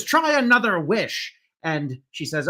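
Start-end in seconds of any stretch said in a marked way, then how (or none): 0.0-1.3 leprechaun voice. Acted. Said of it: "and he says".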